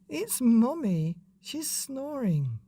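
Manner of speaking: put-on high, slow child; high slow child telling. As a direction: explain like a small boy. Child explains.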